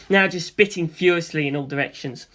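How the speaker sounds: plain reading voice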